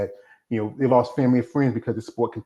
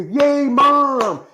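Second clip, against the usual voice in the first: mock kids cheering; 5 dB louder, slower than usual. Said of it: "you both go".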